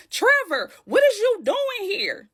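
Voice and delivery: high, indignant disbelief; shocked confrontation. Retold or imitated. Tiffany spots Trevor at the pool, shocked confrontation. Catches boyfriend with another woman.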